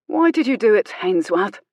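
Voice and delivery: ordinary delivery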